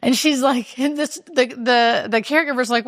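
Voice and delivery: usual speaking voice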